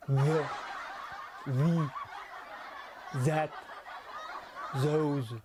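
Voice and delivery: "The", "that", "those" with a laboured, noisy buzzing TH; clumsy French TH. Retold or imitated. French pupils before a laughing class, laboured TH in "The", "that", "those". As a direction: struggle over the TH in "The", "that", "those". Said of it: "le fameux T H anglais".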